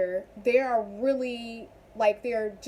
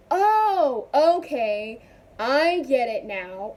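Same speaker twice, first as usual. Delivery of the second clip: dawning realization; 4 dB louder, much slower than usual. Acted. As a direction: act out the penny dropping, slowly. Acting out sudden understanding.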